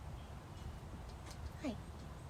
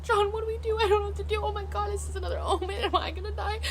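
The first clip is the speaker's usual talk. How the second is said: retold panic in a high, rushed voice; freaking out; 10 semitones higher than usual. Streamer retells calling to John over a bad omen, acted freak-out. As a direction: act out freaking out, pitched way up. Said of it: "I'm like, I'm freaking out".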